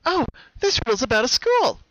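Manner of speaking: high, bright pony voice; Pinky voice. Acted. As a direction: lift into a young mare's puzzled voice. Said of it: "she said".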